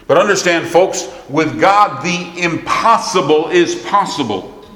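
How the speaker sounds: high, ringing, drawn-out pulpit emphasis; forceful preaching. Real, not acted. Preacher assuring folks of what God can do, forceful and high. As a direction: preach it with weight, stretching key words.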